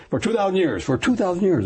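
TWO THOUSAND pitched high for emphasis; insistent, high-pitched stress on "two thousand"; insistent appeal. Retold or imitated. Voicing pastors pressing their argument.

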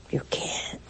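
soft, nearly voiceless murmur; hushed conclusion. Real, not acted. Preacher answering her own challenge to listeners, hushed. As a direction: let it drop away almost to a whisper.

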